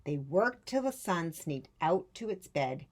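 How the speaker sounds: usual speaking voice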